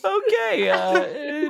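high pitched laughing. Real, not acted. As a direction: laugh through the words. Host cracking up.

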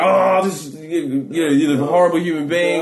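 loud, high mock revulsion; acted disgust. Horrified partygoers to the man on the floor, acted disgust. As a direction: recoil in horror, imitating appalled onlookers. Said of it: "I can hear the disgust".

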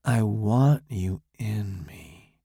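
slow seductive purr; sexy seductive voice. Acted. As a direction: draw it out slowly to lure him. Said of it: "in that same sexy voice".

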